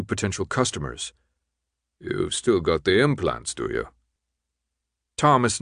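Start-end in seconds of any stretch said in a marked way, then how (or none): 2.0-3.9 gruff man sizing up a customer; gruff character voice, businesslike; gruff, distant man. A narrator's reading. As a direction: let the question sink low at the end. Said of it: "the gruffly distant man named Hans".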